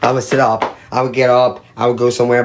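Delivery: ordinary talk, nothing marked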